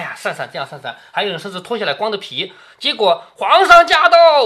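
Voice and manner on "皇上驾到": loud, high, drawn-out proclamation; imitated herald's call. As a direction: call out the emperor's arrival like a palace herald. Imitating a herald announcing the emperor.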